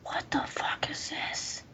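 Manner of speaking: slow, hushed mock whisper. Retelling a stranger's message in a slow whisper. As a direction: breathe the baffled question in a whisper. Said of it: "this guy messaged me".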